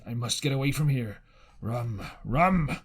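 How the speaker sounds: lightly voiced hoarse sea captain calling for rum; a hint of the reeling old captain pleading; dazed captain. A narrator's reading. Stricken captain to Jim, asking for rum, a lightly voiced plea.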